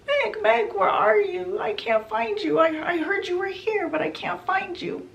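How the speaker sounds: high, plaintive calling; searching mother's voice. Retold or imitated. Mother calls for Meg in the underworld, plaintive and high. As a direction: call out for a lost daughter, pitched well up. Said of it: "hears her mother's voice".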